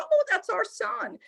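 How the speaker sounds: put-on high, sing-out elderly parent voice; put-on high voice of an old couple. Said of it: "an elderly couple opens".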